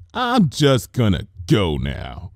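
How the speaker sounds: slow, deadpan exit line; slow flat-toned retreat; slowly. Acted. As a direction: say it slowly, stunned. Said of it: "she said slowly".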